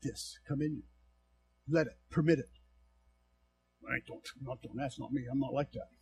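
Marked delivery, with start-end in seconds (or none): 3.9-5.9 soft, low, mumbled excuse; mock excuse. Preacher imitating a reluctant member, softly. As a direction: mimic a reluctant churchgoer. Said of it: "You are so".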